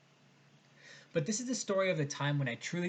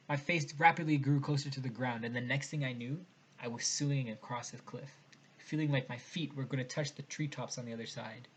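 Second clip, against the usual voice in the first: ordinary delivery, nothing marked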